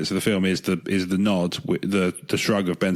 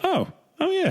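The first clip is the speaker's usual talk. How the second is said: high, sudden dawning oh yeah; sudden realization; realizing surprise. Retold or imitated. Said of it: "I was just like".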